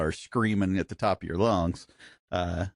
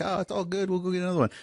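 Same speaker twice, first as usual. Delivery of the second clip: higher-pitched, no-worries guide voice; easygoing American guide; 8 semitones higher, much faster than usual. Retold or imitated. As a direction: imitate a guide brushing it off. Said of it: "they're not American guides. Like here, they're like".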